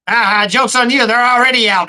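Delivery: squeaky sidekick puppet answering back. Pretend. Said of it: "Bonesy's gonna talk".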